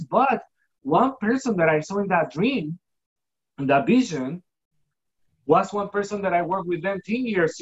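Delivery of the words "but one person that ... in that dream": ordinary talk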